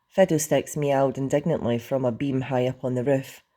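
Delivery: plain reading voice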